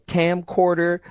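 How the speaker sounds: ordinary delivery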